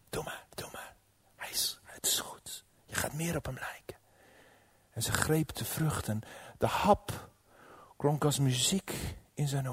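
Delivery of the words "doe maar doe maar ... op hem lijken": very soft, breath-only whisper; whispered coaxing. Acted. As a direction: whisper permission like a tempter. Slang moedigt zachtjes aan. (fluisterend aanmoedigen)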